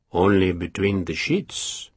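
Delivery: a sly, genial jest. A narrator's reading. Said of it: "Soult said genially".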